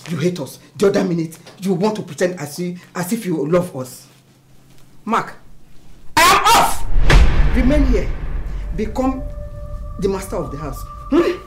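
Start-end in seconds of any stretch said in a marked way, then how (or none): 5.0-10.8 enraged yell; furious parting shout. Acted. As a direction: bellow it as a final word. Acted quarrel, storms off.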